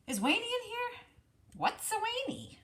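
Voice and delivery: high clucky hen voice. Acted. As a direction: squawk it like a puzzled hen.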